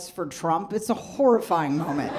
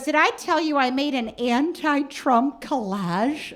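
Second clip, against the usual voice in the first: eager mom voice; 7 semitones higher than usual. Retold or imitated. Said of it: "She goes".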